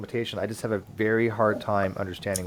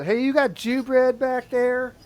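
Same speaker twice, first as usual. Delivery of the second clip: mock customer; 14 semitones higher, slower than usual. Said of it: "I didn't really go".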